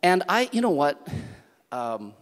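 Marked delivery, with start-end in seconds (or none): 0.0-1.1 high, loud, fervent pulpit voice; full-force preaching. Acted. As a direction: rouse the congregation.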